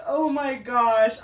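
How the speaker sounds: slow amazed exclaim; overwhelmed gasp. Real, not acted. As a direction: breathe it out in awe. Overwhelmed reaction.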